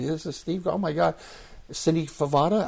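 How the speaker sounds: imitating dad's amazed greeting; surprised dad. Dad meeting Steve Garvey at the golf course, in mock surprise.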